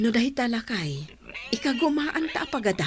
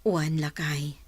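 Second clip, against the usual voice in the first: soft whisper; 7 dB quieter than usual. Acted. Wife answers husband softly.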